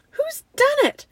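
high, loud demand; distressed cry. A narrator's reading. Susan finding the table empty, distressed cry. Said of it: "cried Susan".

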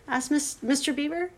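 plain reading voice

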